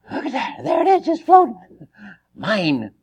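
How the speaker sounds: a fish that spots bait and grabs it; greedy fish. Acted. Preacher voices the fish spotting bait.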